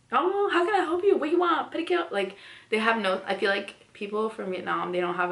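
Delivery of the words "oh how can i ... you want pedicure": high, pushy sing-song questions; nail salon worker. Retold or imitated. Salon worker pushing a pedicure, high pushy chirp. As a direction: rush the customer in a high chirp. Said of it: "I'm like".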